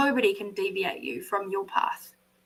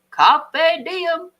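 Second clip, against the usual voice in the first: grand declaration; 7 semitones higher, 7 dB louder than usual. Acted. Dramatic motivational catchphrase.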